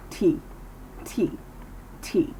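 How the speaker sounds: crisp T with air pushed through the teeth; T sound demo. Shown as a demonstration. Teacher models the sound for students, crisp aspirated T. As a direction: say "T" with a puff of air. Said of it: "when making the T sound".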